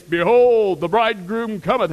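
drawn-out, booming announcement; loud heralding cry. Retold or imitated. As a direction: call it out as a midnight warning. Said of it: "only warning you're gonna get is".

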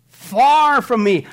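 far from me drawn out loud; slow emphatic. Acted. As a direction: stretch every word slowly.

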